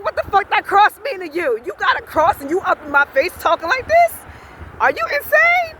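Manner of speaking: high indignant questions, climbing to a peak; retold cussing out. Confronting the man wearing a cross, retold angry cussing. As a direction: retell challenging him, incredulous and angry. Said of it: "I was cussing his ass out".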